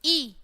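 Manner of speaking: ई said short and quick; short quick vowel. Shown as a demonstration. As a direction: demonstrate ई briefly for pupils. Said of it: "छोटे से हम बोलेंगे जल्दी से". (ई को छोटा, जल्दी से बोलें)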